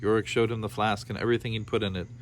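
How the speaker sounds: ordinary delivery, nothing marked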